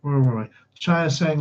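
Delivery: usual speaking voice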